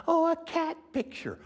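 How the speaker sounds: very high, mock gushing; hammed-up delight at a cat photo; mock excited squeal. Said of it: "some of you say".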